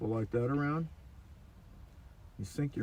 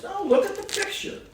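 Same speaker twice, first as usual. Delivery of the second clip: put-on eager old man; 4 dB louder than usual. Old author insists on his photo.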